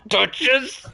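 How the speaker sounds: put-on high cute anime girl. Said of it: "that's a name I haven't heard".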